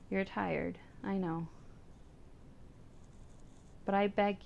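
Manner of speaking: soft, tender reading of a grieving owner's words; story narration. Acted. Streamer reading a dying cat's owner's thoughts, tender narration. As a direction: read it gently, like a quiet farewell to a pet. Reads game story text aloud.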